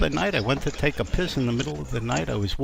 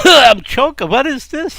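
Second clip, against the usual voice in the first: very high, loud comic protest; mock choking alarm; 13 semitones higher, 12 dB louder than usual. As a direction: splutter in comic outrage at the husband. Wife choking on powder, acted.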